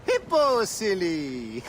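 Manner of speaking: high, loud delighted cry; teasing burst of laughter; gleeful reveal. Acted. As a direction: blurt the answer with glee. Merchant laughs at his confusion.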